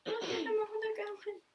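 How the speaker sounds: high excited exclaim. Real, not acted. Showing the child the flying animals, excited. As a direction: point it out with delight.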